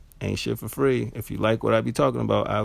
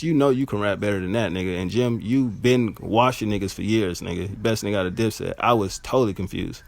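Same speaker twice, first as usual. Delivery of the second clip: ordinary talk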